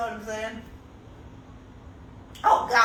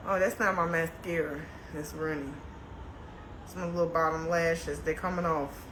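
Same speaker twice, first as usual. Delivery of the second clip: quiet; 7 semitones lower, 8 dB quieter than usual. Real, not acted. Quietly corrects chat about mascara.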